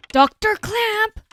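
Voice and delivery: high, drawn-out call. Acted. Character calls for the doctor, long high call. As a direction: stretch the name out, pitched high. Calling again, higher and drawn out.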